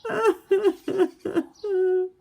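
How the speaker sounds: high, loud mock wail, a step bigger. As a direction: wail out loud like a lonely little beaver. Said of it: "He cried out loud".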